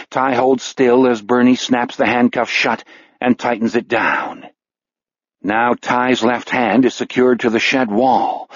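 ordinary delivery, nothing marked

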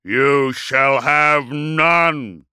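very slow, drawn-out thunderous roar. A narrator's reading. Dreadnought refuses his enemy's parley, roaring. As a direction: roar a refusal, stretching each word. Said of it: "roared Magnoric".

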